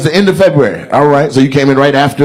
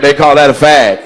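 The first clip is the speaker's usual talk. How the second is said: normal talking voice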